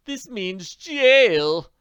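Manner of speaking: slow, high, despairing moan. Acted. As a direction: wail it out, defeated. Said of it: "moaned Newt Phipps".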